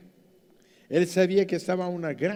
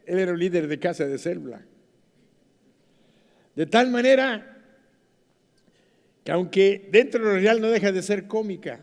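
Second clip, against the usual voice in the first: normal talking voice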